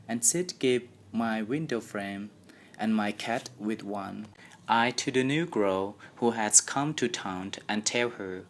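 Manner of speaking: ordinary delivery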